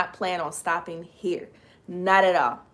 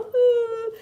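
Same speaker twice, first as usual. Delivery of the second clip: high whoop of joy; excited whoop; 3 dB louder than usual. Real, not acted. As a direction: cheer for herself, way up high. Celebrating magazine feature.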